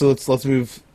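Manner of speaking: normal talking voice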